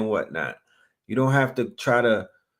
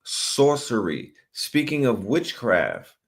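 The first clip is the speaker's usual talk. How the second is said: dramatic segue; 3 semitones higher than usual. Acted. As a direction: tease the next clip like a magic act. Hyped segue into a clip.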